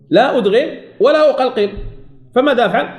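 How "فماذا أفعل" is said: ordinary talk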